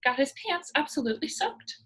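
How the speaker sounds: ordinary delivery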